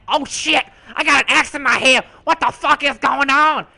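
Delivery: high, loud hammed-up panic; frantic mock screaming; retold panic. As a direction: yell in comic alarm. Panics with axe in head.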